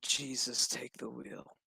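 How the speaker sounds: low, very soft plea; hushed mock prayer. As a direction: let it sink to a near whisper. Repeats the look as prayer.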